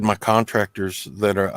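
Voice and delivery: ordinary talk, nothing marked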